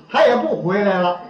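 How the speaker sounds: normal talking voice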